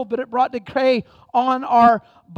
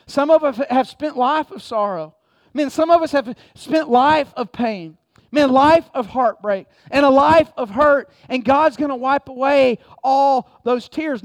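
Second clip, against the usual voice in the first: rising to a louder preaching peak; building preaching; 5 dB louder than usual. Real, not acted. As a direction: build the fervour line by line.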